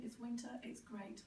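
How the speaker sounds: usual speaking voice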